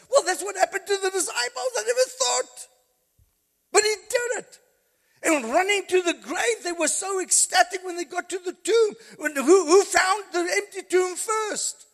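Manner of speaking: high, loud, laughing revival preaching; fired-up Easter sermon; preaching at full force. Acted. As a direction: bring the congregation to its feet.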